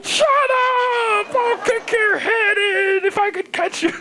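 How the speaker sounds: high, straining mock-angry shout; furious granddad. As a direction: threaten the kid like an irate old man. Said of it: "I feel like your granddad".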